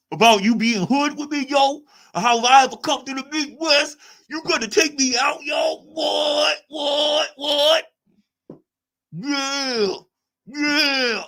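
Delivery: high, loud mocking street voice; mock him as a shouting tough guy; high shouted mock street talk. Mocking G-Man's threats.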